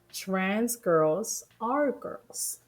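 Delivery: very slow, pointed delivery; slowly spelled out. Real, not acted. Repeating it for an opponent, slowly and pointedly. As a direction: say it word by word, very slowly. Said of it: "say it again for you slowly".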